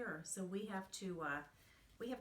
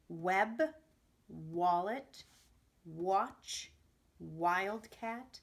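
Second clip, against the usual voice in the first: loud, clear, one word at a time for the class; loud repeat-after-me drill; 8 dB louder, much slower than usual. Shown as a demonstration. Teacher calling out W words for the class to repeat, loudly. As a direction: call each word out so the kids can echo it. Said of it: "so go ahead and repeat after me".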